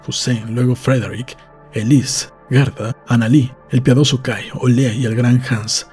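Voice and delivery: ordinary delivery, nothing marked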